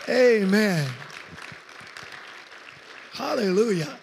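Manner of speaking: normal talking voice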